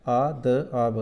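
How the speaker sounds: letters spoken one by one, slowly; slow letter-by-letter spelling for a class; spelled out slowly. Shown as a demonstration. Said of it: "ऐसे".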